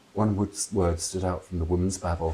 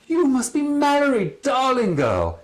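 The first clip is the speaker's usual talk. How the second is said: much higher, louder gushing voice; loud theatrical aunt; 5 dB louder, slower than usual. Acted. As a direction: gush over her like a grand dame. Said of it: "in a loud voice".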